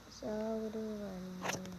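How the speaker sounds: ordinary talk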